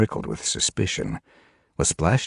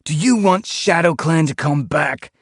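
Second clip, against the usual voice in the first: much higher, louder challenging shout; demanding; 6 dB louder than usual. A narrator's reading. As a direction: throw the question at his father. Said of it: "he demanded".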